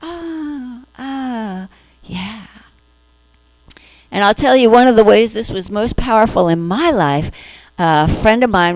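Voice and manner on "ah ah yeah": soft accepting sigh. Retold or imitated. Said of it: "no, you go".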